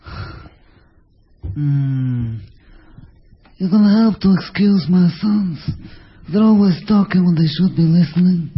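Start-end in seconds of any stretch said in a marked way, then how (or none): 3.6-8.5 slow Godfather voice; Marlon Brando. Acted. Godfather apologizing for his sons and mourning his boy, slow mafia-don impression. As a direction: draw it out like the old mafia don. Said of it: "En El Padrino".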